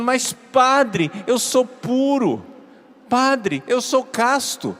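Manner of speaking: high-pitched, drawn-out pleading objection; mock outraged insistence, stretched words; indignant protest. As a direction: imitate an aggrieved young seminarian. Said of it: "o menino ficou revoltado comigo".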